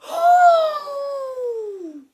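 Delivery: long, loud, high "hooo"; howling wind. Acted. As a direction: howl like a strong wind. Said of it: "sounds like a strong howling wind".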